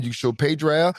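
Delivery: ordinary talk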